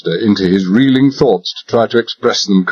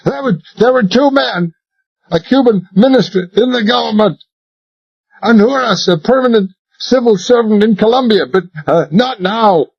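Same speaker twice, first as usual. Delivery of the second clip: high, hurried, pleading; desperate babbling; 12 semitones higher than usual. Acted. Royal spills names to convince Talbot, desperate. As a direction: gabble it to save yourself.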